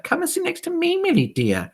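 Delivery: very high, sugary society-lady voice; high, syrupy ladies' chatter; gushing lady. Retold or imitated. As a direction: coo it like a fawning lady at a club meeting. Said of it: "there was a whole lot of stuff like".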